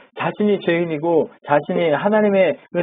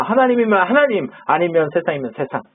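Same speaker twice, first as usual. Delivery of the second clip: normal talking voice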